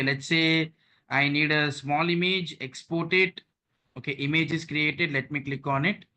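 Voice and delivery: normal talking voice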